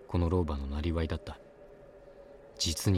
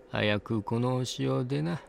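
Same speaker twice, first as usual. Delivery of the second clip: put-on slow, higher old crone urging; high old woman voice; 8 semitones higher, slower than usual. A narrator's reading. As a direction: urge gently like an old hag. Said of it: "ワンオクターブ高い声で".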